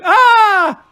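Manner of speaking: very high, loud shriek; hammed-up piercing "aah"; mother's scream. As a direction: scream in shock at the sight. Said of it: "she screamed too".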